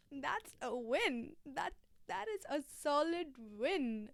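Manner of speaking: slow proud declaration. Acted. Drawn-out self-congratulation.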